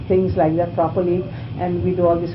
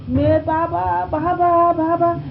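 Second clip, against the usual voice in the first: high, drawn-out mock scream; imitating a long, high cry for rescue; retold scream; 9 semitones higher, slower than usual. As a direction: wail it out slowly, reenacting a scream for help. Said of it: "screamed at the top of my voice".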